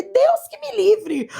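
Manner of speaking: put-on high, slow, dragged-out boy's cry of refusal; teen boy recoiling at the idea; horrified refusal. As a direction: act out the boy's shocked refusal. Boy refusing adoption, retold.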